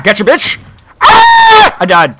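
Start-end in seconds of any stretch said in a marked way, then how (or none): 1.0-1.8 very high, loud shriek; piercing scream on getting killed; sudden high yelp; startled scream. Real, not acted.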